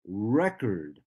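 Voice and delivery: "record" as a noun, REC punched, second syllable weak; stress on first syllable. Shown as a demonstration. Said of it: "say the first syllable stronger".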